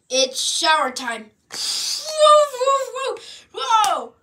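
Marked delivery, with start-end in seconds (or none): 1.5-4.1 shocked yelps. Acted. Cold shower shock.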